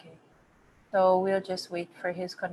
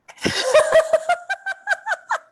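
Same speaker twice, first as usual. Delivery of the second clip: loud high laugh; real laugh, up high; high burst of laughter; 7 dB louder than usual. Real, not acted.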